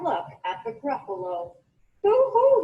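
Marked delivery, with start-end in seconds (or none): 2.0-2.6 much higher, louder frightened hoot; high loud wail; terrified hoot. Acted. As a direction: owl wailing in fright. Owl terrified by gruffalo.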